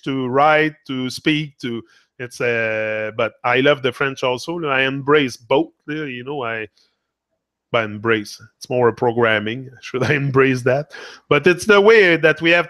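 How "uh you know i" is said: ordinary delivery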